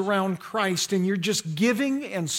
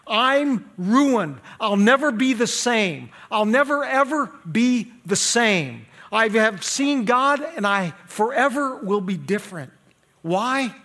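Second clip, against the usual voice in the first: raised, forceful declarations in Isaiah's person; impassioned preaching; 4 semitones higher, 5 dB louder than usual. Acted. Preacher speaking as Isaiah who has seen God, impassioned. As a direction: proclaim it with full conviction, stretching the key words.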